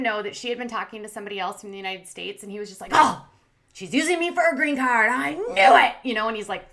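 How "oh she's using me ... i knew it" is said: loud, high mock outburst; flipping out. Vlogger imitating the suitor accusing his girlfriend, hammed-up freak-out. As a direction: imitate an outraged accusation, blurting it at full volume. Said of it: "flipped out and he ran outside".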